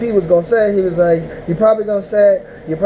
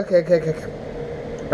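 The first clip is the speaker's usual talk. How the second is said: fading resignation; 3 semitones lower, 8 dB quieter than usual. Retold or imitated. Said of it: "I was like".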